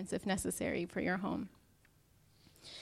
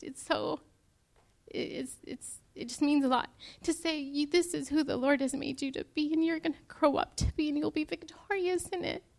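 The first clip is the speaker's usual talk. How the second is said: tearful; 6 semitones higher than usual. Real, not acted. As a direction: voice breaking high with real tears. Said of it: "Sorry, I didn't mean to cry".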